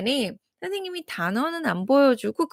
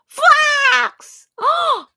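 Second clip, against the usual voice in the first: fox lifted up high and loud; high bright fox; 11 semitones higher, 3 dB louder, much slower than usual. Shown as a demonstration. Teacher blending the fox sound for kids. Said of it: "무슨 소리가 났어요".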